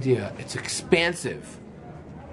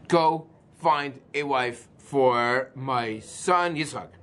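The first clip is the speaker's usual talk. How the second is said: usual speaking voice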